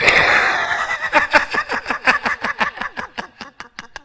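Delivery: high burst of real laughter; audience-facing laugh; big high laugh. Real, not acted. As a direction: crack up at his own joke. Laughs at own joke.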